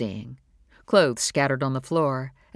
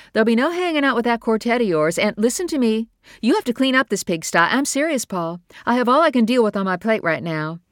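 fast, high, loud fed-up scolding; scolding mother; 8 semitones higher, 5 dB louder than usual. Acted. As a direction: rattle off the rules like an overwhelmed mom.

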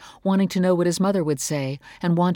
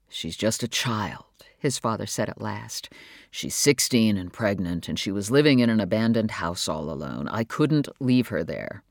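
father's low voice; 6 semitones lower than usual. Acted. Father defending his choice to his wife, low and calm. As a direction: speak low and steady as the patient father.

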